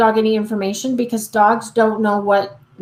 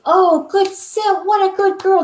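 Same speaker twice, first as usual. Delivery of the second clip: over-excited praise; 8 semitones higher than usual. Shown as a demonstration. Owner praising her sitting dog, over-excited. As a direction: overdo the praise so the dog gets amped. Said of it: "then she's jumping again 'cause she's all excited".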